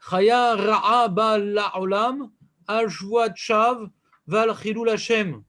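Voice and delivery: slow, chanted reading of the Hebrew text; measured study-hall chant; slow recitation. Shown as a demonstration. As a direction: intone the Mishnah line by line, drawn out. Teacher recites the Mishnah text.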